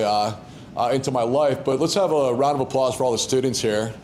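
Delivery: ordinary talk, nothing marked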